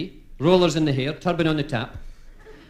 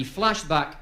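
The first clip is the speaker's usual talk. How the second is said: ordinary talk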